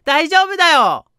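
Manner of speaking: put-on high, loud, slow chirpy girl; forced cheerful girl saying she's fine; girl's voice. Voicing the crying girl. (無理に明るい女の子の声)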